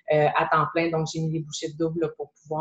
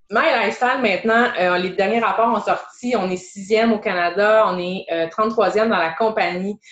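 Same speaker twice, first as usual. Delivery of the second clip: normal talking voice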